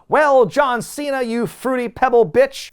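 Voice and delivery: high, loud wrestling call-out; big hammed-up taunt; mock promo taunt. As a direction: imitate a wrestler hurling an insult, drawn out. Acting out Rock's promo insult.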